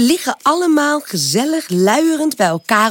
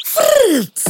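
high trilled wing flutter; 13 semitones higher than usual. A narrator's reading. Butterfly leaping ever higher than Pip, whirring flutter. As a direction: imitate a butterfly whirring upward. Imitated sound of butterfly wings.

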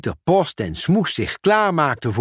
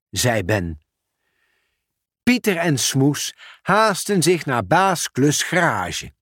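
plain reading voice

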